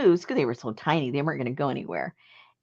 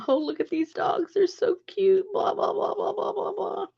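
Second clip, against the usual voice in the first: high, mock gushing friend. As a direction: coo over the cute dogs in a high voice. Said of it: "he told my husband".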